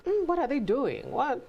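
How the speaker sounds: half-playful baffled question; puzzled wondering. Retold or imitated.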